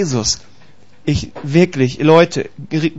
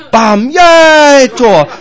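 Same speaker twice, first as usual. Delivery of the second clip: high, loud drawn-out cheer; bam, then a long high yell; mock goal cheer; 7 semitones higher, 7 dB louder, much slower than usual. Preacher mimicking himself cheering at the goal DVD, mock goal cheer.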